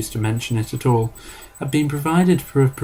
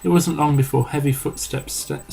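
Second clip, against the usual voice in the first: ordinary delivery, nothing marked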